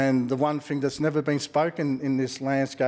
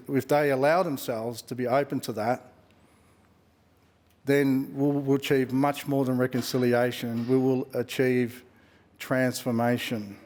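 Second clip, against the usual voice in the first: usual speaking voice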